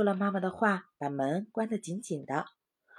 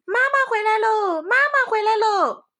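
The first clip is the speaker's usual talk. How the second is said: very high, loud happy cry; excited calling; 13 semitones higher, 8 dB louder than usual. A narrator's reading. Red Eyes calls out thinking mother is back. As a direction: yell out in delight. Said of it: "就叫道".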